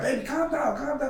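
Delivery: put-on high-pitched mother calming her child; imitated soothing mother. Mother calming frustrated patient son.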